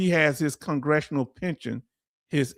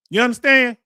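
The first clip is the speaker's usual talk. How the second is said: higher, louder, demanding shout; angry shout; 8 semitones higher, 7 dB louder than usual. Real, not acted. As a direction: hammer it at them, furious. Angry rant at war braggers.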